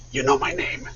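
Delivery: breathless starstruck whisper; awed whisper. Retold or imitated. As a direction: hush it in disbelief, like meeting an idol. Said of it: "I was like".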